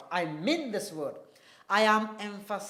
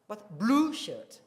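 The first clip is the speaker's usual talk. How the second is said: blue drawn out and leaned on; stress on blue, slow; slower than usual. Shown as a demonstration. Teacher contrasting colours, blue stressed. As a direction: say blue slowly and firmly. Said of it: "you mean one thing and not other thing".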